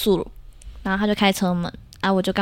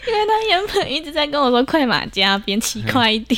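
laughing while telling; 7 semitones higher, 3 dB louder than usual. Real, not acted.

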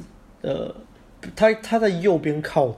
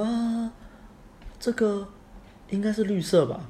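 hesitant girl impression; 8 semitones higher, slower than usual. Girl unsure about her hair colour, high hesitant imitation. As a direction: put on a high, hesitant girl's voice.

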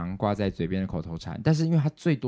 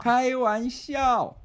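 very slow, much higher drawn-out "开玩笑"; imitating dad; 12 semitones higher, much slower than usual. Daughter imitating her father's catchphrase, slow and high. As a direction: stretch it out like dad's catchphrase. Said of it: "爸爸的口头禅".

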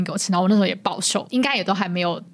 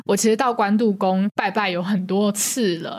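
ordinary talk

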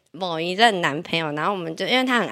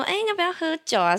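flirty hostess; 10 semitones higher than usual. Retold or imitated. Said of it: "说".

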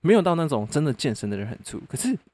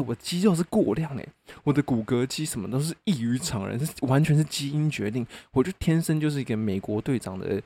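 ordinary talk

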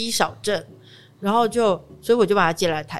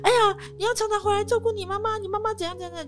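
high, whiny auntie scolding, almost wailing; nagging neighbor; 14 semitones higher than usual. Retold or imitated. Neighbor auntie nags him to visit mom, high whiny scolding. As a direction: pile on the guilt like a nosy neighbor. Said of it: "然后就有邻居说".

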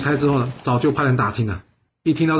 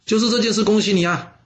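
higher-pitched mild congratulation; pleased announcement, raised pitch; congratulatory; 7 semitones higher than usual. A narrator's reading. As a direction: offer lightly acted good news, pitched up. Envoy congratulates the son.

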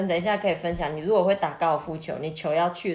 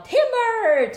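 mild mock warning call; demonstrated yell; 6 dB louder than usual. Shown as a demonstration. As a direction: call it out inside the sentence. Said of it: "So you have to yell".

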